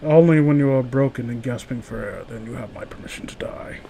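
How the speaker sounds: low, softening villain voice sinking to a whisper; put-on masked villain, hushed by the end; Bane. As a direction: menace quietly, fading into whisper. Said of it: "Bane's lines in The Dark Knight Rises".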